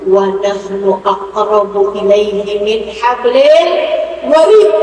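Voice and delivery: slow, drawn-out chanted verse; Quran recitation. Acted. Preacher to the congregation, chanting a slow Quran verse. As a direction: recite the verse slowly, then lift the last word.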